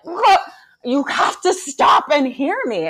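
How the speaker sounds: grip him and make him listen; intense plea. Retold or imitated. Mom reenacts intense plea.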